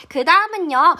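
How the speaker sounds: normal talking voice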